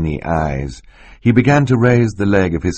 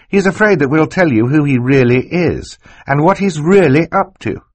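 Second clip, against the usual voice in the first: put-on high, louder, urgent child voice; acted high-voiced girl, insistent; urgent girl's accusation; 10 semitones higher, 5 dB louder than usual. A narrator's reading. Violet speaks up to accuse Stefano.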